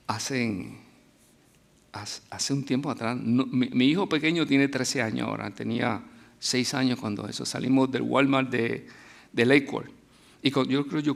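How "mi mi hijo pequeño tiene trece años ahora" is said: usual speaking voice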